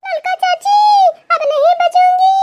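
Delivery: high, loud anguished crying out; crying out in pain. Acted. Woman in labor cries to mother-in-law she won't survive.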